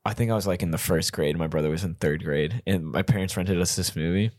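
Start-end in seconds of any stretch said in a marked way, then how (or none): none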